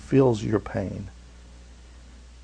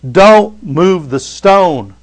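loud, higher pleading, slowing hard at the end; fearful refusal; 4 semitones higher, 7 dB louder than usual. Retold or imitated. Pastor voices people who dread facing their past, loud pleading. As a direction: beg them to leave the stone, voice rising in panic. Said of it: "They say".

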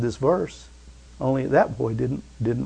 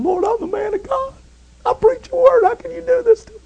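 high, far-off anguished plea; crying out to God. Retold or imitated. Preacher recalls protesting to God, high anguished plea. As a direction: cry out to the Lord in protest. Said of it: "I remember saying".

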